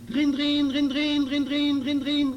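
high, loud imitated bell ringing; piercing, evenly repeated "drin-drin"; noisy bicycle bell. A narrator's reading. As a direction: ring it out like a bike bell. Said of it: "retentir bruyamment sa sonnette".

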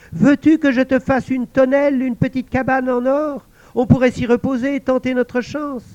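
high, pleased voice; a lonely spirit delighted at last; invisible servant exclaiming. Acted. Servant thanking the guard, high excited voice.

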